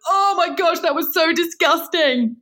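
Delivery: high-pitched horrified squeal of revulsion; acted disgust. Acts out her disgusted reaction.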